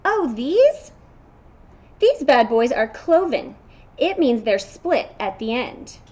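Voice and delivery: storybook goat showing off; proud goat. Acted. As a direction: boast about your bad-boy hooves. Goat proudly explains cloven hooves.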